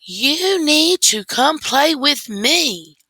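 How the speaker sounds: put-on high, slow, demanding little-girl call; little sister calling her brother away from the TV, a high slow demand; little sister yelling. A narrator's reading. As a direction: whine it out like a bored toddler wanting attention. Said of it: "she yelled".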